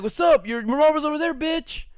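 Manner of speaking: high mocking taunt from a schoolyard bully. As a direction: sneer it like a kid picking a fight.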